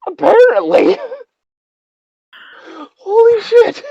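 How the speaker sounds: breathless and keyed up; rattled. Real, not acted. Rattled after the jump scare.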